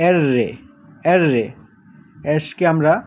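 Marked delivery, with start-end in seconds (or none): 0.1-1.4 এররে with a rolled, doubled rr; Italian letter R. Shown as a demonstration. Teacher models Italian R for the class, rolling it. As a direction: roll the rr in এররে. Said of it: "Italian বর্ণমালায় বলবো".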